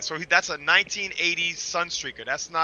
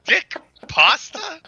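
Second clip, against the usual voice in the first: high, slow incredulous repeat; disbelief; 13 semitones higher, 6 dB louder, much slower than usual. Real, not acted. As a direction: say it like you can't believe it. Incredulous at a strange phrase.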